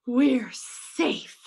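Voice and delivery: breathy, drawn-out relief. Acted. Relief after the rescue.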